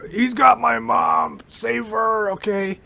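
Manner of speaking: pleading hero voice. Pretend. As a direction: beg for help, lightly voiced hero. Improvised Superman line.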